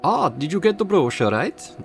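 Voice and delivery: put-on high, slow German accent drifting Swedish; a German accent that wanders off into Swedish; accent slipping into Swedish. Receptionist asking about the brochure, accent slipping Swedish. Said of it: "Oh, she's gone Swedish now".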